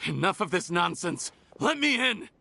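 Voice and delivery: rising to a furious high shout; angry demand. Acted. Man at the door yelling at guards to let him in, angry. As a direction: force your way past the guards.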